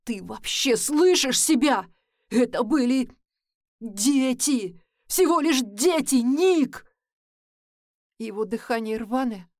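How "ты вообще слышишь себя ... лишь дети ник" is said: louder, trembling with rage; shaking with anger. Acted. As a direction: rage and despair in the voice. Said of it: "Голос дрожит от ярости и отчаяния".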